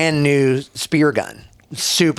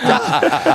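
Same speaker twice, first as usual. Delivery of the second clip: mock scream through laughter; high-pitched scream. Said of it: "I scream like a bitch".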